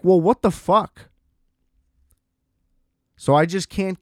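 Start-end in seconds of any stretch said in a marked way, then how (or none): 0.0-1.1 exasperated disbelief. Real, not acted. Exasperated outburst.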